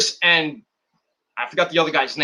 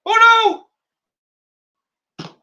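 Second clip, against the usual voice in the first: surprised; 5 dB louder than usual. Real, not acted. Reacting to jobber's win.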